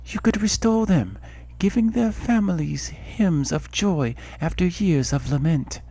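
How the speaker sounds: a goddess warning of tragedy; solemn goddess voice. Acted. Goddess voice continues.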